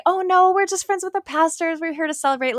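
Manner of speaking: light mock brush-off; breezy brush-off. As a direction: wave her off cheerfully, as a retold aside. Retells brushing off the woman.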